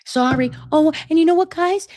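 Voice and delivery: fake sweet apology. Mock sugary apology.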